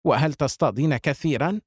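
plain reading voice

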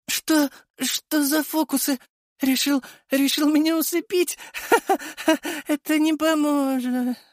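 high, faltering with a crazed laugh; stammering villain fighting sleep. A narrator's reading. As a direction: stumble and laugh off the drowsiness. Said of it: "Затихая".

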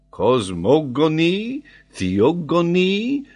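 high, loud, drawn-out list; French geographer listing. Acted. Geographer offers possible readings, emphatic. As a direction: stretch each word, lifting the ending. Paganel lists possible words.

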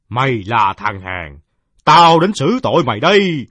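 slow, then high and loud piercing rat; shrill rat voice. Acted. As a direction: threaten like a vengeful rat. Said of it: "vang lên lanh lảnh, mãnh liệt như tiếng gió rít". (giọng chuột lanh lảnh như gió rít)

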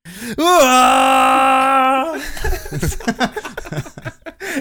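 long laughing dinosaur call. Pretend. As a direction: bellow a silly dino cry through laughter. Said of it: "[name], make a dinosaur noise".

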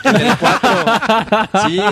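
slow words through a laugh. Real, not acted. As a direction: chuckle the words out. Laughing over the joke.

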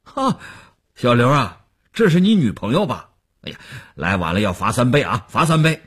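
loud, high-pitched banquet heckle; calling out. A narrator's reading. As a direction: call across the table, teasing the latecomer. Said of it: "这时桌上有人叫". (在酒桌上高声起哄招呼)

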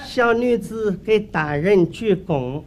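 slow, stretched Henan-dialect greeting; Henan accent. Acted. Wife bows to the magistrate, slow Henan-accented stage speech. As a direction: draw out each phrase in Henan opera dialect. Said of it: "他不唱河南坠子".

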